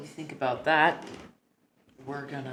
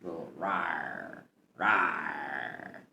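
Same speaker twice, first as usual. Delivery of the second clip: low, slow playful little growl; a cute mock roar; mock lion cub rawr; 6 semitones lower, 5 dB quieter, much slower than usual. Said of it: "She makes mocking, like, cat claw paws at you".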